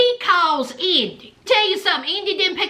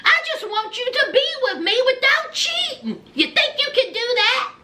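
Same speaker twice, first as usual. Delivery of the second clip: higher, quicker pleading woman's voice; higher mock Indy; 6 semitones higher than usual. Host voicing Indy pleading with Harry, higher and quicker. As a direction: pitch it up and rush it like Indy. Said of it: "And so Indy said".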